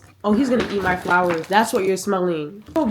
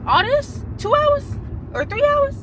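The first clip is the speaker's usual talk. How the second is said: much higher, disbelieving questions; can't believe that estimate; incredulous disbelief. Real, not acted. Hair would take longer.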